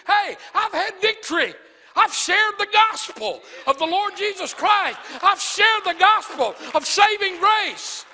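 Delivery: loud, high, impassioned preaching; rising revival sermon shout; full-force preaching. Acted. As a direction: preach it to the rafters.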